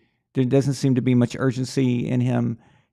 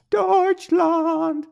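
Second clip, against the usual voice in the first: very high, drawn-out singsong cry; high-pitched Deutschland. Pretend. Host playing up his German for viewers, high silly voice. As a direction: hoist the whole word way up high, stretched out. Same word repeated in high voice.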